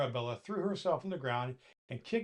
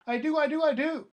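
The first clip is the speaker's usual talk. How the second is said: high, loud tearful whine; whining doll; 13 semitones higher, 7 dB louder than usual. Acted. Doll pleading with Santa, high tearful whine. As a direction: insist through tears.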